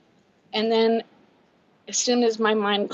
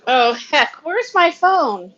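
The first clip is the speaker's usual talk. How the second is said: high, loud and slow, searching; frantic call; 6 semitones higher, 9 dB louder, much slower than usual. Real, not acted. Vlogger hunting for her lost phone, high loud slow call. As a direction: cry it out, high and drawn out.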